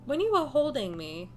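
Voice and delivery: reading the game's lines in a steady story voice. Acted. Streamer reading the heroine's lines aloud, steady storytelling. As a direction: read it out like a visual novel heroine.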